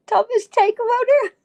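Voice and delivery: high, loud disbelieving question; incredulous. Acted. Vlogger scoffing at the Norwegian title. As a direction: scoff at the silly name.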